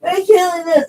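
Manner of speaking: high-pitched mock panic, whining about being killed. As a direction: squeal it like a scared man playing the victim.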